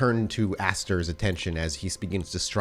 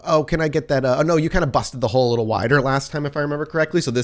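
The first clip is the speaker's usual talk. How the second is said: higher, fast, chatty brute; fast, higher friendly Max rambling; 6 semitones higher, 5 dB louder, much faster than usual. Acted. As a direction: rattle it off cheerfully as Max. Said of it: "Out crawls Max with a".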